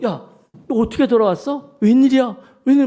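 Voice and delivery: mother stunned to see her daughter come home; startled mother. Retold or imitated. Mother startled as the runaway daughter walks in, exclaiming. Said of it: "엄마가 깜짝 놀라가지고".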